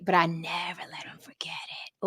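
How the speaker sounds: a secret slipped in under the breath; whispered confession; whispered aside. Real, not acted. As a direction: drop to a low whisper.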